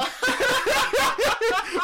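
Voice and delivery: shrieking cackle; loud high laugh. Real, not acted. Cracking up at own joke.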